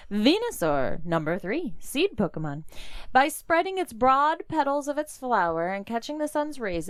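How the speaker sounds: normal talking voice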